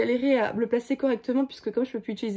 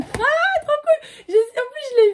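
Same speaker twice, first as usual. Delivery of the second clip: very high, loud real delight; excited squeal; 14 semitones higher, 6 dB louder, slower than usual. Real, not acted. Vlogger unwraps a book she had spotted, squealing with excitement. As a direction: squeal with joy, then gush.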